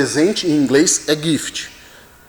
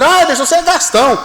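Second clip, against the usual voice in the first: high, quick mock complaint; playful teasing; 11 semitones higher, faster than usual. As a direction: imitate a teasing jab, pitched way up. Voices Silvana teasing him. (provocação brincalhona, aguda e rápida, imitada)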